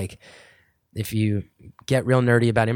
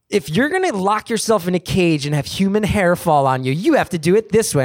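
higher, louder mock bossy order; retold yelling executive; 6 semitones higher, 3 dB louder than usual. Network executive instructing a comedian, mock yelling. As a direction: imitate an executive laying down the law. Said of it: "every time they'd start yelling at us".

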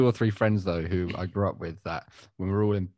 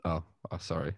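normal talking voice

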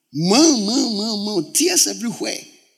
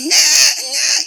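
put-on high, loud wailing baby cry; 6 dB louder than usual. Pastor imitating his sick baby crying in hospital, loud high wail. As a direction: wail like a newborn in distress.

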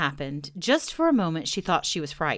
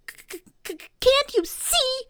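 very high squeal after stuck consonants; stutter, then burst out shrieking; stammering shout; 4 dB louder than usual. A narrator's reading. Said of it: "shouted Piglet".

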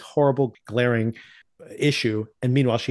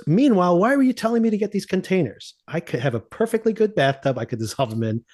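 incredulous pitched-up complaint; mock exasperation; 6 semitones higher than usual. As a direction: sound baffled and fed up. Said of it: "Walt gets home and goes".

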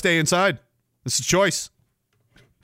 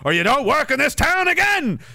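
mock gatekeeper threat; 10 semitones higher than usual. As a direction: sneer it like a Hollywood gatekeeper. Imitating old gatekeepers' threat.

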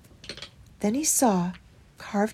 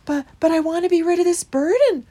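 high, louder, mildly weepy plea; tearful mouse; 9 semitones higher, 7 dB louder than usual. A narrator's reading. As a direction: plead with a touch of weeping. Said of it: "wept poor Christopher".

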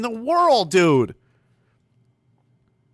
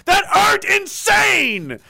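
building shout; 11 semitones higher than usual. Real, not acted. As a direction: rise into a high, drawn-out yell. Rant builds to an outburst.

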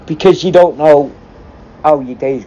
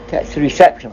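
ordinary delivery

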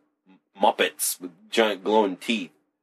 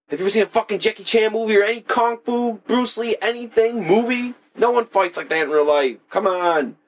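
high, exasperated mock rant; a squeaky incredulous rant at the coworker; 13 semitones higher than usual. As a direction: ham up his own disbelief at the guy. Arguing with coworker, retold.

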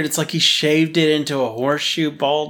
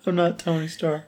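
whimpering; 5 semitones higher, 4 dB quieter than usual. Retold or imitated. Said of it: "He said it all whimpering".

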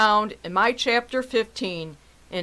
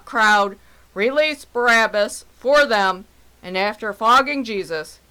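ordinary talk, nothing marked

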